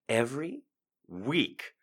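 soft, defeated mutter; deflated. Acted. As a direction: let the air out. Deflated after the rant.

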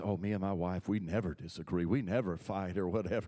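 low, soft mock-pious preacher voice; mock pious husband bragging about his marriage; mocking lying preacher. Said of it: "there's some lying preachers out there".

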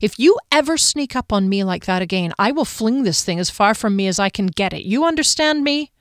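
ordinary delivery

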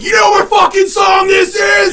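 loud high stage yell; rock star shout. Retold or imitated. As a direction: roar it at the crowd. Rock star yelling at crowd.

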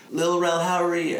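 lightly hammed-up creepy shout from outside; creepy stranger calling in. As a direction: lure him in with a falsely friendly high voice.

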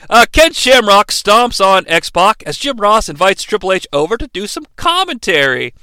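ordinary delivery

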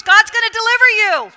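loud, high rousing proclamation; proclaiming Moses. Acted. Moses proclaiming deliverance to the Hebrews, loud and rousing. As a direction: shout the promise to the crowd.

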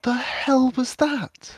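stunned after a narrow escape; shaken. Acted. Shaken after fleeing the forest.

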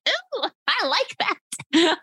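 high, slow, delighted ooh. Retold or imitated. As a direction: savour the compliment slowly. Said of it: "I said".

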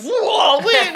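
high, startled exclamation; amazed whoa. Retold or imitated. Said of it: "everyone's like".

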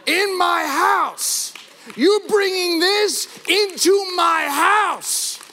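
high, loud, slow outraged shout; thundering God. Pretend. God confronting the congregation, slow booming shout. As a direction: thunder it as God rebuking you. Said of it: "God just looks at you and says".

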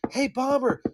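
high whiny asking voice; mock begging friend. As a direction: wheedle for weed like a needy buddy.

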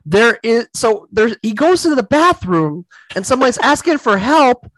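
excited disbelief. Real, not acted. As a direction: wind up the shocking reveal, drawing it out high. Retelling a shocking movie scene.

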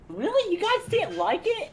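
very high, laughing disbelief; mock surprise. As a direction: act shocked anyone disliked it.

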